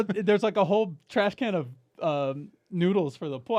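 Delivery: mock outrage at her; exasperated. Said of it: "And I go".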